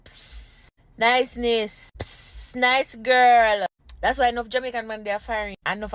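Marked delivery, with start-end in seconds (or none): 0.0-3.7 hissed psst, then admiring call; mock catcall with tyre-like hisses; imitated street catcall. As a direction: catcall like a man trailing a woman down the road. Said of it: "sound like a beer bus tire follow back of you".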